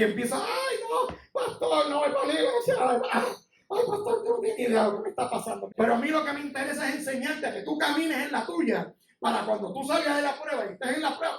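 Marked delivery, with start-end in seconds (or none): 0.4-5.7 high-pitched hammed-up lament; mock whining. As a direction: imitate a churchgoer moaning to the pastor. Mimics afflicted member complaining to pastor.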